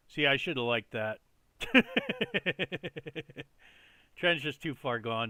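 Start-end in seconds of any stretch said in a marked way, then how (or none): 1.6-3.4 brief amused chuckle; short laugh. Real, not acted. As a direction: laugh it off lightly. Laughs at own missed trade.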